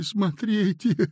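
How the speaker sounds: slow, stretched, pleading; plaintive, drawn out. A narrator's reading. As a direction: drag the word out helplessly. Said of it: "жалобно сказал Синему".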